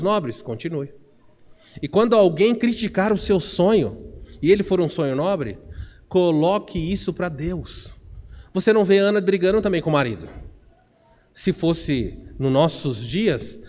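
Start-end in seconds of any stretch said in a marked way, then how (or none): none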